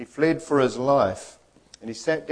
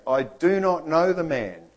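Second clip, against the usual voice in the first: somewhat raised, emphatic denial; mild swearing denial; 5 semitones higher, 4 dB louder than usual. Acted. Peter denies Jesus with curses, acted with mild force. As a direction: insist on it, pitched up. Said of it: "with curses and swearing".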